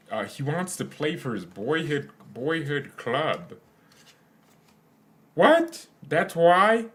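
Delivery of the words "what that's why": high, loud disbelieving yelp; shocked what. Real, not acted. Streamer reacting to a transfer reason with loud shock. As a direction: blurt it out in total disbelief.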